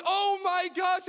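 high, loud panicked cry; freaked out. Retold or imitated. Boy hearing he's adopted, high panicked cry. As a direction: gasp it out in high alarm.

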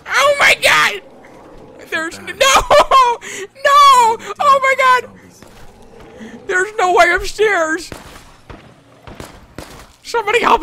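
high, loud game-panic yelling; panicked screaming. Pretend. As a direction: scream it, stretching out each "no". Panics while trapped in game.

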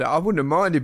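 normal talking voice

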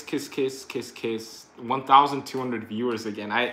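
ordinary delivery

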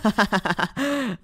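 high-pitched string of ha-has; high laugh. Acted. Robot laughs as Shady is called in, high laugh. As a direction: laugh at a friend in trouble.

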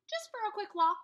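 high, loud reply; calling back. Acted. Jack calling back to his dad, loud and high. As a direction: call it back casually.

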